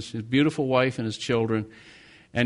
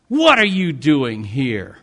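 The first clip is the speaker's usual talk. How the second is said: snarl; 6 dB louder, slower than usual. Retold or imitated. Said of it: "he snarls at me".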